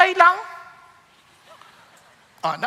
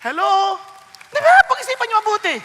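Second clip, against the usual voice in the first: ordinary delivery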